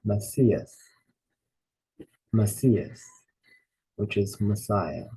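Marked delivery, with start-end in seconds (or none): 0.0-0.8 Messias, read out clearly for the class; teacher modelling Messias; Greek vocabulary word. Shown as a demonstration. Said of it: "The first word is". 2.3-3.0 Messias, read out clearly for the class; teacher modelling Messias; Greek vocabulary word. Shown as a demonstration. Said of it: "The first word is".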